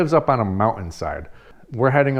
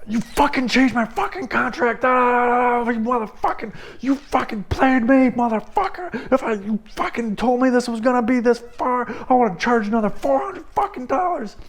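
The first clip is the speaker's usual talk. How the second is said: high-pitched, strained cursing tirade; acted-out screaming rant without much extra volume; re-enacted furious yelling; 13 semitones higher than usual. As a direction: tear into him at the top of your range. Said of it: "continued to yell at him".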